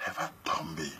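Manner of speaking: unvoiced, hushed "Elle va tomber."; quiet aside. Shown as a demonstration. Telling the men what happens next, in a whisper. Quiet setup before loud repeat.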